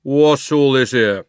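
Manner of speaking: loud, slow official inquiry; slow, loud policeman. Acted. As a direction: demand an explanation from the crowd. Policeman voiced.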